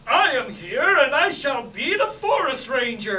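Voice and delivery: loud, grand rescuer's proclamation; heroic announcement. Retold or imitated. Improv player rescues the stalled scene with a heroic cry. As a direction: swoop in like a hero. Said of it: "somebody swoops in and goes".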